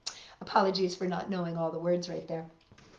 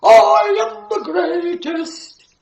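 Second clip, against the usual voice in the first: booming high shout of a boastful bear; roaring bear; 10 semitones higher, 15 dB louder, slower than usual. A narrator's reading. Bear roaring to the forest that he is strongest. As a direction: roar it like a bragging giant bear.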